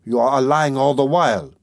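crying out. A narrator's reading. Suspect accusing the examining lawyer, crying out. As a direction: cry out the accusation with some force. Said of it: "he cried".

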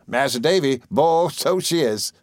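high, drawn-out rustic exclamation; crying out as Ham. A narrator's reading. Ham chuckling over Emily, high admiring cry. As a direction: stretch it out slowly and high. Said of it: "cried Ham".

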